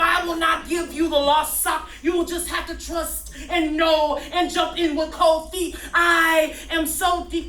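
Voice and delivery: loud, driving spoken-word rant; hammed-up slam-poet intensity; fired-up slam poetry. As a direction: preach the nonsense at full force. Shouts the parody poem.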